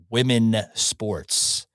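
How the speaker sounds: slow, stress on "Women"; slow emphasis. Real, not acted. Host driving home his point, slow and stressed. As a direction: lean on "Women" and draw it out. Host repeating slogan slowly.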